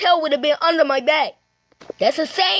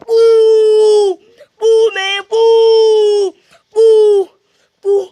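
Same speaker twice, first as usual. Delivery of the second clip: loud, high drawn-out howls; pretend fight yells; 4 semitones higher, 9 dB louder, much slower than usual. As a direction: wail each hit out in mock battle.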